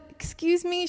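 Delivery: lightly acted, hesitant approach to a stranger; tentative interruption. Leaning down to a worshiping girl, tentative.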